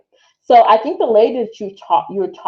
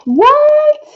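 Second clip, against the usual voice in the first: sudden high shocked cry; shocked what; 7 dB louder than usual. Real, not acted. Streamer reacting to a runner's ban, shocked high cry. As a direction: blurt it out in total disbelief. Stunned by news about runner.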